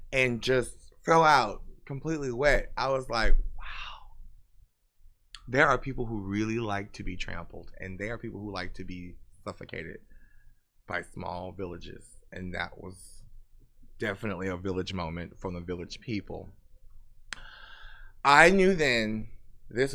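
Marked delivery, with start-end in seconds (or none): 3.6-4.2 hushed, whispered wow of disbelief; stunned wow. Retold or imitated. Reacting to the man falling out, stunned whisper. 5.5-16.5 low, much softer, measured explanation; hushed aside. Real, not acted. Explaining what some people like, in a hushed low voice. Deadpan aftermath of shocking story.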